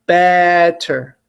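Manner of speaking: BET-ter, first syllable strong; stress on BET. Shown as a demonstration. Teacher drilling syllable stress, BET-ter. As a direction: lean on the first part of "Better". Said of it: "Which part is the E in?".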